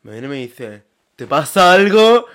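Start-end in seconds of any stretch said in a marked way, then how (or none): 1.2-2.3 slow, loud, threatening high challenge; menacing challenge. Retold or imitated. Stranger on the metro threatening her, loud slow menace. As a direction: bark it at her like a thug picking a fight.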